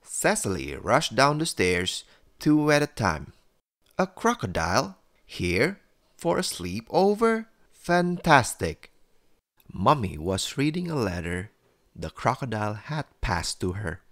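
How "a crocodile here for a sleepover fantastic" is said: put-on high, thrilled little girl; excited child. A narrator's reading. Cecily greets a crocodile at her sleepover, excited.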